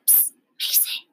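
unvoiced whispered psst. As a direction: whisper it to stay hidden. Someone calls secretly.

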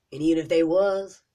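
even if they was, with was hit loud; stressed. Real, not acted. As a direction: punch was hard. Louder repeat of a point.

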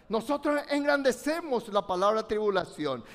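usual speaking voice

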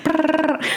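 buzzing jittery brrr, pitched high; brrr jittery shiver. Retold or imitated. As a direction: shake it out like a caffeine jolt. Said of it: "I'm gonna be like".

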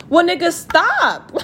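high, slow, drawn-out demand; exasperated retort. Acted. Woman answering his complaint about phone calls, high exasperated retort. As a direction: stretch it out high, fed up. Snapping back at his nagging messages.

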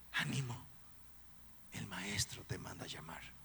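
low whispered call; whispered encouragement. Acted. Crowd whispering to Bartimeo that the Master calls him, encouraging. People telling Bartimeo to come.

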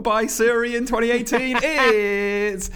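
high, drawn-out showbiz intro; grand announcement. Acted. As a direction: announce her like a star.

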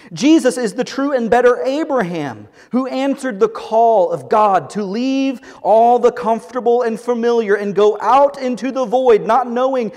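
forceful preaching. Acted. Pastor reading Tim Keller's lines aloud, forceful preaching. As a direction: proclaim it like a rallying cry.

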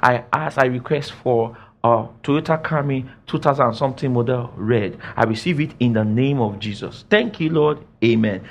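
prayer. Shown as a demonstration. Preacher showing how to ask for a car, low matter-of-fact prayer. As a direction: pray it low and plainly. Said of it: "and you say".

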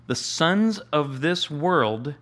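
scripture read slowly and deliberately. Real, not acted. Preacher reading Jesus' words to the congregation, slowly. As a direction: lean into each word of the verse. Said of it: "Jesus says".

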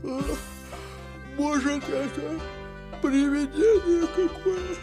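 high, spooked guess at a ghost; frightened. Acted. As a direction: wonder fearfully, pitch climbing. Dwarves fear a ghost in house.

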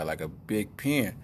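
ordinary talk, nothing marked

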